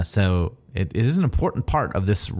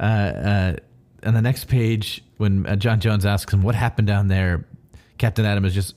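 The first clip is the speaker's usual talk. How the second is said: ordinary talk, nothing marked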